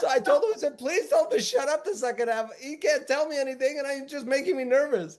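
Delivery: laughing. Real, not acted. Retelling while laughing.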